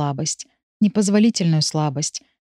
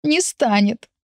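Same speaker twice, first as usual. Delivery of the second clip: higher, tearful and hoarse; sobbing cry; 5 semitones higher, slower than usual. Acted. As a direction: sob the words out. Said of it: "всхлипываю я сиплым шёпотом".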